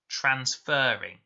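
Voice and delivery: transferring said slowly, the fer drawn out long; teacher slowly sounding out transferring, fer held long and stressed. Shown as a demonstration. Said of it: "Is it making a long or a short sound?".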